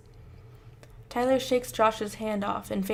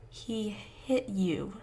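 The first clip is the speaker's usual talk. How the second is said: very slow, softer disbelief; 5 dB quieter, much slower than usual. Acted. Mother slowly repeating what son said, very slow and soft. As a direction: let each word sink slowly. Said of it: "she says slowly".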